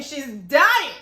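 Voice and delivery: loud retold outburst, stretched on "dying"; shouting at the screen. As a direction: re-enact yelling at a show, half laughing. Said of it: "I literally shouted at the screen".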